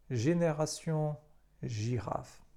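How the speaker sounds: "génération" and "girafe" with G as Ż; French pronunciation. Shown as a demonstration. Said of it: "tak jak w słowach".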